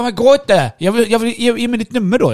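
high, loud mock pleading girl. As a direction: squeak it out like the clingy girl begging. Imitates the girl begging.

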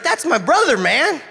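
high, loud, laughing protest; mock Aaron. Aaron defending his brother, high and loud. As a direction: squeak it out as offended Aaron. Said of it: "And Aaron's like".

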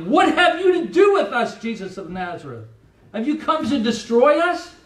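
terrified demon crying out before Jesus; anxious demon cry. Acted. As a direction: cry out like a cornered demon in dread. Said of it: "hear the anxiety in the voice of that demon".

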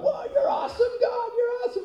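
very high, laughing squeal; giddy angel squeal. Acted. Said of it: "they're already jumping up and down".

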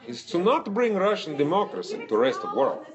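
put-on high, heavy Russian accent. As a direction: boast like a gruff Russian. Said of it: "your Russian accent".

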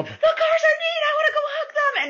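put-on high, wailing little girl; mock toddler whine; kid voice. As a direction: whine it like a three-year-old. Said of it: "She was like".

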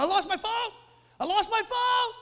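high, frantic mock wail over a lost phone; mock panic. Spouse searching the house for the phone, high mock panic. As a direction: play the spouse hunting for the phone in a hammed-up panic. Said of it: "when they start in a panic".

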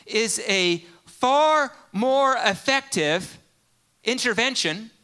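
loud, slow, high preaching climax; full-force preaching. Real, not acted.

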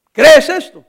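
loud, ringing "¿Crees esto?"; loud question. Acted. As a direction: press the question on the listener. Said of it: "Jesus' question again". (pregunta fuerte y directa)

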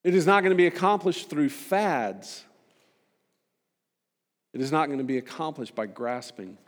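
normal talking voice

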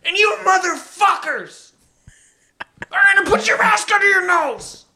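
high shouty manic impression; Tom Cruise rant. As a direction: shout it like a manic movie star. Impersonating Tom Cruise ranting.

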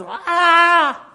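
loud, high, long "aah" scream; mock panicked scream. Preacher acts a viewer swamped by YouTube prophecies, mock scream. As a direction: scream as if overwhelmed, hammed-up. Acted panic after end-times videos.

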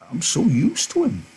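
put-on very high, strained old man's voice; cling to the dog with every word; pleading old Candy. Old Candy begging to keep his dog, very high.

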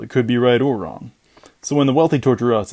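ordinary delivery

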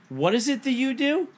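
high, pointed mock-innocent question; sarcastic challenge. Confronting the freeloading neighbor, high sarcastic challenge. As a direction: ask it like a trap.

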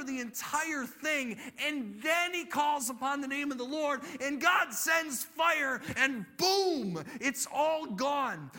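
high, loud, emphatic storytelling; full-force preaching. A narrator's reading. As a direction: drive the miracle home with punched words.

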